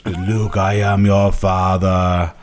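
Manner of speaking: slow, bad Vader voice; Darth Vader impression. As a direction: drag it out like a poor Vader. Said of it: "a really terrible impression".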